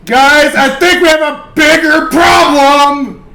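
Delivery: exasperated shout. Acted. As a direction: shout the warning to the party. Player shouting at the table.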